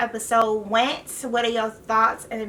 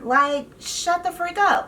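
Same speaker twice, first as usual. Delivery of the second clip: higher and slow, fed-up snap; exasperated outburst; 8 semitones higher than usual. Real, not acted. Ranting at Leah on TV, exasperated and slow. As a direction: spell out how done you are with her.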